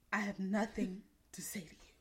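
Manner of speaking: whispered with a laugh inside. Real, not acted. Telling strangers not to talk.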